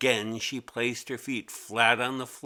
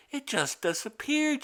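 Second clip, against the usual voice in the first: put-on much higher, puzzled little girl; 13 semitones higher than usual. A narrator's reading. Mimi hunting her desk for the lost pencil, high puzzled voice. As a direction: ask it like a confused kid. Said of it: "she said".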